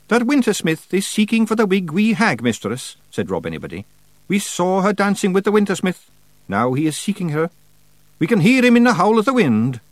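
high, quick Scottish pixie voice; cheeky little Scots warrior; Scottish Feegle voice. A narrator's reading. Rob Anybody warns.

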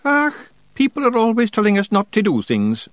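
high, quick Scottish pixie voice; cheeky little Scots warrior; Scottish Feegle voice. A narrator's reading. Rob Anybody speaks.

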